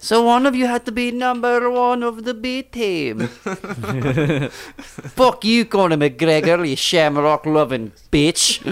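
high put-on Scottish taunt; Scottish accent. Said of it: "The Scottish and the Irish".

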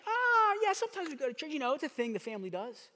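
very high, evasive ah yeah; squeaky, high-pitched downplaying; sheepish shrug. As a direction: brush it off, embarrassed. Evasive believer downplays church.

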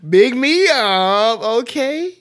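high, slow, hammed-up demand for praise; drawn-out playful vanity, laughing; mock diva. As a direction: preen like a diva fishing for compliments. Teasing partner to praise her.

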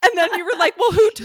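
mock shock. Retells friend's shocked question.

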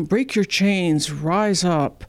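usual speaking voice

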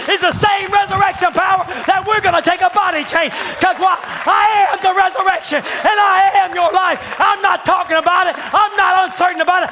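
high, loud shouted proclamation; full-force preaching as Jesus. Acted. Preacher voicing Jesus to the church, booming.

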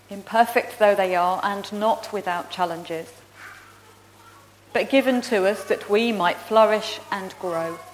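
earnest liturgical prayer voice. Acted. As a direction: lead the church in prayer, measured and reverent. Leads congregation in prayer.

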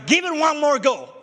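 called out loud and high, full force; loud resolve. Retold or imitated. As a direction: shout the decision like a rallying cry. Resolve cried out in testimony.

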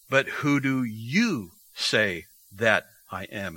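slow question with "you" drawn out; emphatic YOU. Retold or imitated. Preacher quoting Jesus' question to the disciples, emphatic "you". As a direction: lean long and slow on "you". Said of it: "that is emphatic".

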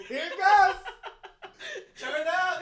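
high, loud hype shout; excited shout. Real, not acted. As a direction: hype it up like a party. Hyping excitedly.

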